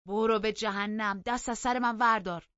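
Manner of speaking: loud, high, furious rebuff; angry retort. A narrator's reading. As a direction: lash back angrily at full voice. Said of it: "با غضب جواب داد".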